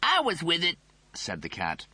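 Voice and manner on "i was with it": cocky dude voice; cool cat voice. Acted. The cat speaks.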